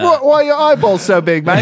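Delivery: high-pitched hammed-up British accent. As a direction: play a puzzled British mate, pitched high. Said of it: "going to town on these British accents".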